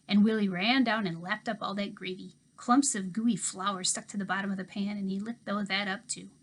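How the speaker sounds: plain reading voice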